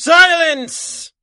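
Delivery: booming, high shout to silence the room; villain's roar. Acted. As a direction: bellow it like a supervillain making an entrance. Villain bursts into meeting.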